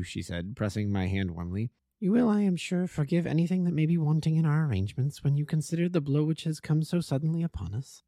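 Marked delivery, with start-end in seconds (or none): 2.0-8.0 higher, gracious lady's voice, holding back worry; wife welcoming the detective, composed but anxious. A narrator's reading. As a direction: play the brave hostess. Narrator voices Mrs St Clair.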